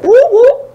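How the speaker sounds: high-pitched double whoop; imitated whoop whoop. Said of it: "I'd be like".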